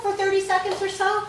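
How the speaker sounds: ordinary delivery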